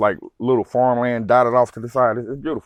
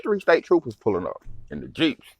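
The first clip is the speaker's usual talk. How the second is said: ordinary talk, nothing marked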